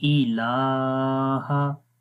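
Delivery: इलाहा with the लाम vowel held a full alif, very slow; lengthened vowel. Shown as a demonstration. Teacher models the standing zabar, very slow recitation. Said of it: "इसको भी लंबा करेंगे".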